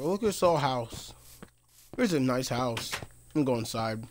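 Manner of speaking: ordinary talk, nothing marked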